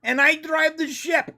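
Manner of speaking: high, put-on proclaiming voice; Ortegas. Co-host playing the ship's pilot, hammed-up proclamation. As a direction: announce yourself in a hammed-up high voice.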